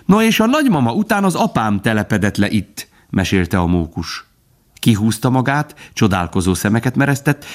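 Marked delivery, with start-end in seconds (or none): 0.0-2.9 high, excited squirrel voice; chatty squirrel. A narrator's reading. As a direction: prattle on eagerly with leaps up in pitch. (csacsogó, magas hangú mókus)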